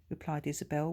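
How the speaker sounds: plain reading voice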